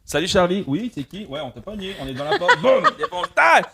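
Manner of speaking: playful police raid. Pretend. Acted police raid scene.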